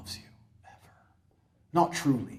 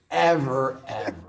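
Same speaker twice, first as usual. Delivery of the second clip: ordinary delivery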